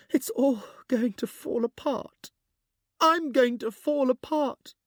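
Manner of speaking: put-on high, loud, breaking young voice; gasping. A narrator's reading. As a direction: gasp it out, falling apart. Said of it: "and then gasped".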